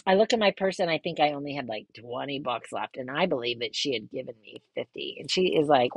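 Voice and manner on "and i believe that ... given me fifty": usual speaking voice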